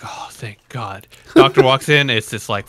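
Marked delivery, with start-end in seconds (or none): 0.0-1.1 slow, soft sigh of relief; hushed relief. Retold or imitated. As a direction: let it out quietly and slowly, relieved. Retold relief at the news.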